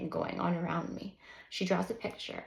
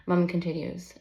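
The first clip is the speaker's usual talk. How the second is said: ordinary delivery, nothing marked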